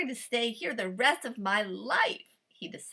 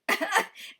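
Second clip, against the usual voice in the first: gloating laugh; 9 semitones higher than usual. A narrator's reading. Farmer laughs.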